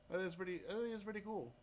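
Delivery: usual speaking voice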